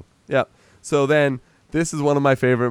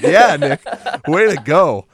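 laughing cheer; 2 semitones higher, 5 dB louder than usual. Real, not acted.

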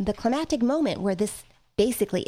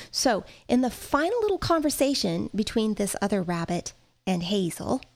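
usual speaking voice

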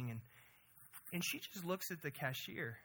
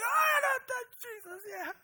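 loud, much higher, flustered cover-up cry; panicked fake cheer; 9 dB louder than usual. Mother covering for her daughter with the cashier, flustered high cry. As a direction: blurt it out to smooth over the awkward moment. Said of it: "I'm like".

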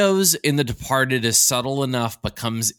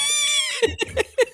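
big laugh. Real, not acted. Laughing at bad accent.

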